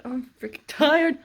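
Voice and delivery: loud, high, drawn-out complaint; exasperated, stretched-out grumble; tired exasperation. Real, not acted. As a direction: moan it slowly and loudly, worn out. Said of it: "I'm so tired".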